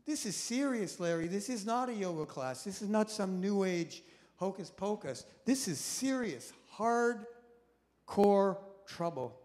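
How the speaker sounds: self-scolding voice. Retold or imitated. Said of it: "I felt like".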